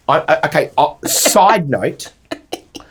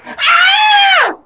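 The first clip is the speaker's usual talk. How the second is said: extremely high held squeal; piercing, drawn-out mock shriek; imitated child's scream; 4 dB louder than usual. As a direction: mimic a toddler shrieking back. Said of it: "Obed Oliver's like".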